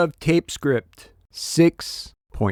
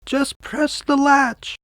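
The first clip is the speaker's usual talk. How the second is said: put-on much higher, slow frail old voice; a sick grandmother calling from bed; weak old grandmother; 13 semitones higher, slower than usual. Grandmother answering from her sickbed, high slow frail voice. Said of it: "Grandmother replied".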